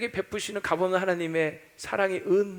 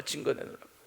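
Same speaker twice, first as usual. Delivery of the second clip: muttered demand; 4 semitones lower, 11 dB quieter than usual. Retold or imitated. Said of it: "뭘 사랑하냐고".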